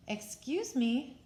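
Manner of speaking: polite cricket. A narrator's reading. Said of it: "coughed a cricket".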